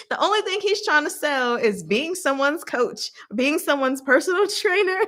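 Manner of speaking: high, giggling through the words; amused, can barely get it out; chuckling mockery; laughing. Real, not acted. Laughing over his sales pitch.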